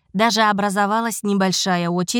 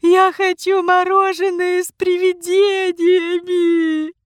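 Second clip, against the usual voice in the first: put-on high, slow, bawling little girl; loud wailing child; 9 semitones higher, 4 dB louder, slower than usual. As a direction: howl it like a spoiled kid. Child demanding loudly.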